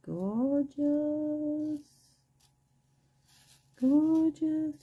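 high, delighted cooing; high gushing. Real, not acted. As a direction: gush over it in a high voice.

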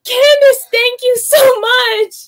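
high, loud, tearful thanks; heartfelt cry of thanks; overwhelmed gratitude. Real, not acted. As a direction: gush it out, close to crying. Thanking the donor, near tears.

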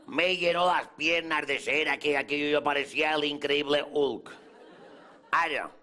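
ordinary talk

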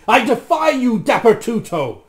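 loud, high shout of defiance; defiant cry. A narrator's reading. Rasmus breaks free of the magician, crying out defiantly. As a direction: cry out defiantly at full volume. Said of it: "cried Rasmus as the spell weakened".

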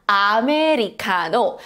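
Americano said with even Korean syllables, "a-me-ri-ka-no"; Korean pronunciation. Shown as a demonstration. Teacher shows learners the Korean word, Korean-pronounced Americano. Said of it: "English words in Korean writing".

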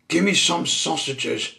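high, loud, rude demand; snapped. A narrator's reading. Mister Grumpy orders from Percy the butcher, snapping. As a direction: snap out the order. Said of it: "snapped Mister Grumpy".

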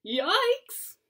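high, loud cartoon panic; alarmed yelp. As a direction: yelp in mock fright. Narrator alarmed at the summons.

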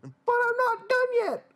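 high, whining complaint; whiny protest. Acted. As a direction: squeal it out like a spoiled kid. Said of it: "I frowned".

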